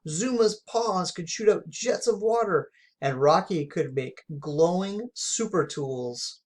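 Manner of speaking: ordinary delivery, nothing marked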